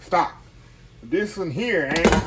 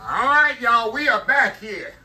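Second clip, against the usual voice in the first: loud, high welcome back; excited greeting; 7 semitones higher, 6 dB louder than usual. Real, not acted. Host greeting viewers back after the break, loud and excited. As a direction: burst back in front of the camera, pumped.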